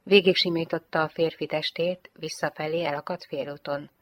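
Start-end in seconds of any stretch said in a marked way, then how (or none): none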